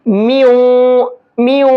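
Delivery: میو with the nunation merged into the waw and nasalised; nasal merge with waw. Shown as a demonstration. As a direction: say میو with the n sound blended into و and a ghunna hum. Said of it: "کیسے؟". (تنوین کو واو میں ملا کر غنہ کے ساتھ میو)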